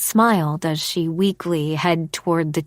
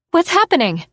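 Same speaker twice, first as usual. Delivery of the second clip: higher and louder, worried cry; alarmed friend; 11 semitones higher, 5 dB louder than usual. Acted. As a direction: call out in sudden alarm. Ivy alarmed at fainting friend.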